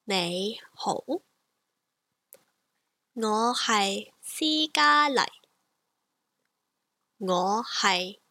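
each syllable slow and careful; slow demo sentence; slow model phrase. Shown as a demonstration. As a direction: draw the sentence out for learners. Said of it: "So I'll say it again".

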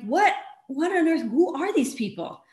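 high, bewildered exclamation; imitating stunned agents; baffled. FBI baffled by kidnappers' driving.

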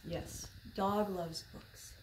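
lively, slow picture-book reading voice; reading to children. A narrator's reading. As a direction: read it like story time for little kids.

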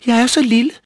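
high, loud, squeaky pleading; frightened little goat. A narrator's reading. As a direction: plead for mercy as a tiny scared goat. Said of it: "blev meget bange".

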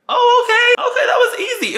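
very high, loud laughing disbelief; surprised laugh. Retold or imitated. Narrator surprised boyfriend agreed, high laughing squeal. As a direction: squeal it out, shocked it was that easy.

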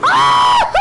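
long loud scream breaking into high whoops; full-blown panicked scream; yelling scream. Real, not acted. Player yelling in panic, loud scream. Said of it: "I'm yelling now".

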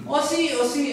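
high strained voice. Shown as a demonstration. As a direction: push the voice up into the throat. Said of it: "pero hablan así".

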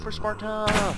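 high, loud mock battle cry; hammed-up charge into battle. Gamer charging a zombie, yelling a mock battle cry.

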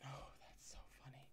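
very soft, amused whisper; whispered aside. Real, not acted. Reader reacting to the story, whispered aside. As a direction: whisper it to yourself, barely audible.

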